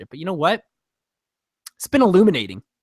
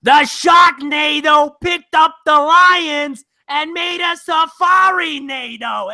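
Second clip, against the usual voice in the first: high, slow screeching shout; squawking comedian voice, way up high; Gilbert Gottfried impression; 8 semitones higher, 5 dB louder, much slower than usual. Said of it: "Gilbert Gottfried is back".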